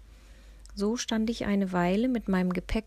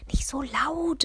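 higher-pitched admonishing hush; ermahnend; 7 semitones higher, 3 dB louder, slower than usual. A narrator's reading. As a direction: scold a friend mildly. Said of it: "ermahnte ich sie". (leicht ermahnend, mit höherer Stimme)

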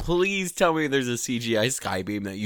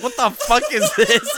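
high, loud, laughing mock outrage; mock bewildered crowd; 9 semitones higher, 6 dB louder than usual. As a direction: imitate baffled onlookers while cracking up. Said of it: "Everybody's like".

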